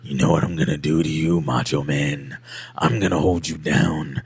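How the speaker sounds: low, quiet, menacing calm rasp; calm threat in a low gravelly voice; Jake the Snake voice. Retold or imitated. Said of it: "he had that gravelly ass voice".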